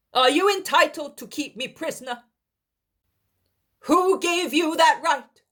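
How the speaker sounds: high, loud firm reply; defiant refusal. Acted. Daniel refusing Helkai and leaving, high loud defiance. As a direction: refuse to be kept.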